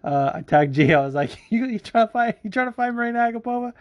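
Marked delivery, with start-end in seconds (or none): 1.5-3.7 high-pitched teasing call-out; mock challenge. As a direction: pitch it way up, playful goading. Acting out own teasing online message.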